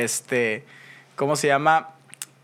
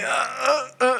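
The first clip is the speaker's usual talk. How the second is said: high, frozen stammer; mock stammering panic; 9 semitones higher, much slower than usual. Pretending to freeze before armed men, high stammer. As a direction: freeze up and stutter in fake fear.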